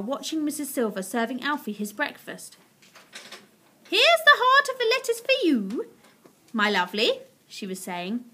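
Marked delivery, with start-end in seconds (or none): 3.9-7.2 high, loud fond cooing; doting pet owner. Acted. Missus Silver serving Alfie breakfast, high doting voice. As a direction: coo loudly to an adored pet. Woman fondly feeding her pet tortoise.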